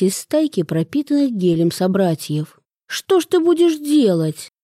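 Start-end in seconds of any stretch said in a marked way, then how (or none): 2.9-4.5 high, mildly exasperated; indignant. A narrator's reading. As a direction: complain as if fed up with it. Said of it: "возмутился Артём". (слегка возмущённо)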